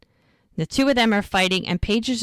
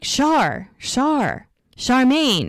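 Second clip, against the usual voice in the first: mock yell, higher pitch, falling on each name; retold calling out; 5 semitones higher, much slower than usual. Friends searching the house for Charmaine, retold calling out. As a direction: call her name out, lightly acted. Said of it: "they're yelling for her".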